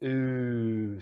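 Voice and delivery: long, held "oo"; long vowel. Shown as a demonstration. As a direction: stretch out the oo with rounded lips. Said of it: "has the long vowel sound".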